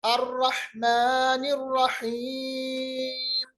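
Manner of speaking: "अर्रहमानिर्रहीम" slowly, its final long ee held out; long stretched yaa. Shown as a demonstration. Teacher showing the stretch in "अर्रहमानिर्रहीम", long held yaa. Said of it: "हमने काफी ज्यादा खींचा".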